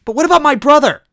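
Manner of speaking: put-on high, loud desperate girlish yell; Toadette yelling. Toadette yelling at Smithy over her brother. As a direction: cry out for her lost brother. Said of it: "Toadette yelled".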